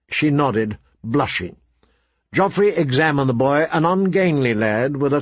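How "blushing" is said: usual speaking voice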